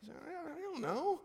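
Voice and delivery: high, slow, helpless imitation of a wife; bewildered wife. Wife to husband over screaming daughter, bewildered. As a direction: stammer it high and at a loss. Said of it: "my wife looked at me and said".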